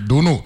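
"Duno" with a u vowel, first syllable stressed; Crucian pronunciation. Shown as a demonstration. A host teasing a guest about saying "Duno". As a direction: say "Duno" the local way. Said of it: "So I just wanna respect them".

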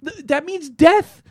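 high disbelieving outburst; can't believe what you read; incredulous. Real, not acted.

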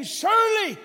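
high, loud cry of conviction; confident shout. Acted. Pastor voicing David's faith, confident shout. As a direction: shout it out as a declaration. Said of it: "See, David is saying".

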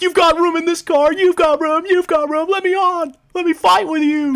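high-pitched mock pleading to be let on; begging, acted. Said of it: "Robbie is begging them".

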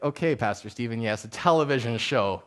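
mock indulgent congregant, voice dropped low; unimpressed listener. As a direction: humor the pastor with little enthusiasm. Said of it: "I know that that one's kind of a, a, like".

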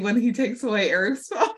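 laughs through the words, pitch up; can't keep the laugh out of the sentence. Real, not acted. Recounting a funny moment to her cohost, laughing.

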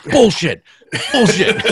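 high, loud call of bullshit; loud catchphrase. Real, not acted. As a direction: shout it like a button push. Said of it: "I just need a button that I just push".

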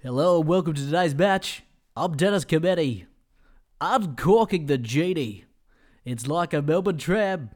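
lightly voiced footy commentator; a hint of a TV sports caller; Dennis Cometti. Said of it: "my impersonation of Dennis Cometti".

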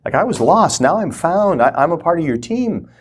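joyful privileged prayer; grateful joy. Retold or imitated. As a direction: gush with grateful delight. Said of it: "an attitude out of love".

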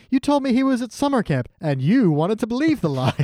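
high, nagging mock Marge; Marge scolding. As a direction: scold it like a fed-up mom. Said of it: "Marge just going".

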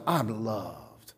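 hushed, low "I'm loved"; soft affirmation. Shown as a demonstration. Preacher voicing a believer's hushed self-reminder. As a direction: say it softly to yourself.

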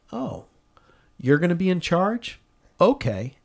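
sarcastic retort. Retold or imitated. Sister-in-law snaps back sarcastically.

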